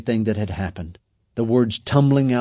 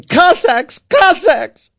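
high, very loud repeated yell; desperate, furious shouts; shouting; 9 dB louder, much slower than usual. A narrator's reading. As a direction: scream the insult while fighting back.

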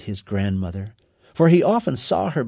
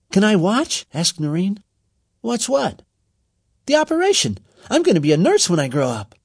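put-on girl's voice; 8 semitones higher than usual. A narrator's reading. Girl Noreen speaks.

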